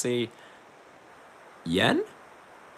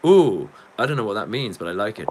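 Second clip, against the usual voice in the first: normal talking voice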